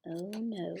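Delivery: usual speaking voice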